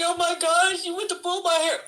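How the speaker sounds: high, wailing mock distress; hammed-up screaming. Acting out the hair-pulling for onlookers, hammed-up screaming. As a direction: play up the hair-pulling outrage. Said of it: "I started screaming".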